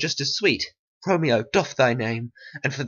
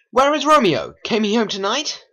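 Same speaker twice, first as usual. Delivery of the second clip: calling out; 7 semitones higher than usual. Acted. Anxious search for Romeo.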